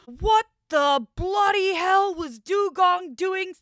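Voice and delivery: high, slow, drawn-out indignant impression; outraged roommate. Storyteller imitating the roommate next morning, outraged complaint. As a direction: mimic an incredulous complaining roommate. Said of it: "comes out, and he goes".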